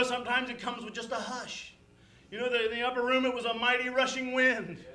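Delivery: impassioned pulpit delivery with loud bursts; emphatic, heartfelt revival preaching; fervent preaching. Acted. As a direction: preach it with conviction to the saints. Preaching with rising fervour.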